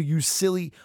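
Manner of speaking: high, exasperated yell; angry outburst. Real, not acted. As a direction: berate him at full tilt. Angry outburst at the actor's comments.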